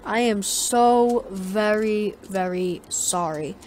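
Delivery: slow, drawn-out, fake-sincere sorry; mock apology. Kid mocking the lady with an overdone slow apology. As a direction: lay the apology on thick and slow.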